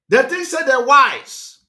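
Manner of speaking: loud, high, drawn-out jeer. Real, not acted. Speaker mocking Benin people to his viewers, loud jeer. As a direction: jeer it out loudly, stretching the words.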